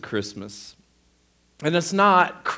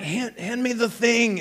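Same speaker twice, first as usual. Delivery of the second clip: higher-pitched retold pleading demand; insistent demand; 7 semitones higher than usual. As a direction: act out a half-asleep, urgent request. Retold sleepy demand to wife.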